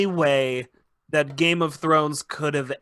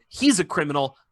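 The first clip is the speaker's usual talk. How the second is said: usual speaking voice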